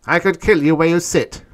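high, loud, grated threat. A narrator's reading. Tanub threatening to kill Orn, grated threat. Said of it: "grated Tanub".

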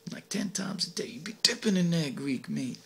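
naughty whispered aside. Acted. Narrator confiding to the crowd, whispered. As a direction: lean in and whisper the dirty part.

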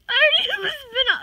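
fast, high, loud excitement; excited. Real, not acted. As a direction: blurt it out, thrilled. Thrilled at spin-off.

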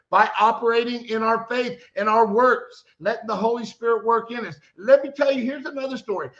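ordinary talk

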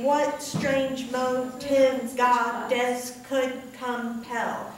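slow, each syllable drawn out for the chin drop; slow syllable-by-syllable reading. Shown as a demonstration. Teacher slowly sounding out a poetry line for the class. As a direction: read it slowly so every syllable lands. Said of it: "Each time your chin drops is one syllable".

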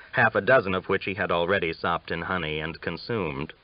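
ordinary delivery, nothing marked

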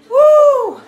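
loud, high "woo"; big pump-up cheer; energized whoop. Real, not acted. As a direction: belt out a high workout whoop. Cheering on mid-workout.

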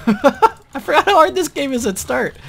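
relieved laughter through words; laughing. Real, not acted. Laughing after near death.

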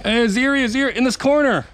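high, loud call across the field. Real, not acted. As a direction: shout to a teammate. Calling a teammate during a game.

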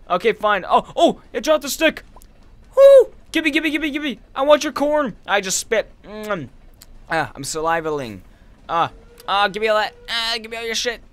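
high, loud, greedy excitement; real excitement grabbing items dropped by monsters; excited squeal. Real, not acted. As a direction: shriek for the loot as it drops. Greedy thrill as enemies drop loot.

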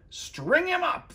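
high, slow mob demand; angry mob shout. A narrator's reading. The town men turn on Big Anthony, high slow shout. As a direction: call for punishment. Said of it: "the men of the town shouted".

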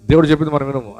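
ordinary talk, nothing marked

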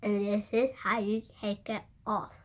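slight lisp from retainer; speaking around palate plate; retainer in mouth. Real, not acted. Seen 4: Retainer seen in close-ups before; removed after, at +3.3 onward.

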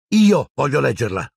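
put-on boy's furious insistence. A narrator's reading. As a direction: stand your ground in anger. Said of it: "disse Harry furioso".

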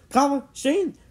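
high, urgent shouted command; shouted order. A narrator's reading. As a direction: summon your friends urgently. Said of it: "Jimmy shouted".

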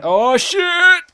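very high, loud shriek of alarm; startled yell. Real, not acted. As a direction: yelp as if caught off guard. Startled by sudden game danger.

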